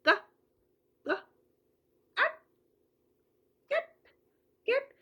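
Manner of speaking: क said twice, then एट, then कैट; word split into sounds. Shown as a demonstration. Mother shows parents how to break a word into sounds. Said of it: "इस तरीके से".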